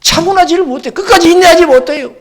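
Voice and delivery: high, loud and pressing; fervent preaching shout. Real, not acted. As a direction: drive it home at full force. Preaching climax. (높고 크게 외치는 설교)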